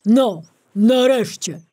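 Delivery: grumbling bear. Acted. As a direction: grumble it as you grab the jar. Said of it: "burknął tylko".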